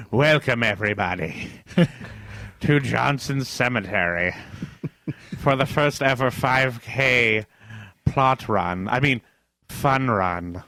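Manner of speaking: very slow, halting announcer; deadpan ceremonial welcome; mock solemn host. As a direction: welcome the crowd with long grave pauses. Acted solemn host opening the race.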